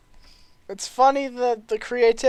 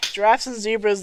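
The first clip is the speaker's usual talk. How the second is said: normal talking voice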